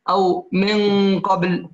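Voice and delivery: من قبل with the n hidden in a slow ghunna toward ق; slow drawn-out nasal hum; nasal hiding. Shown as a demonstration. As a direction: hum the nun back toward the ق. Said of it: "كقولنا مثلًا".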